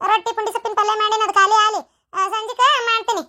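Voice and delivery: daughter-in-law fending off her mother-in-law, exasperated; exasperated protest. Acted. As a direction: pile up excuses at a nagging elder.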